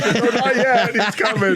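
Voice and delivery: high, laughing fake-innocent excuse; dad fibbing to the coach on the phone, giggling; laughing mock dad. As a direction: play the dad lying through a laugh. Dad covering for son on phone.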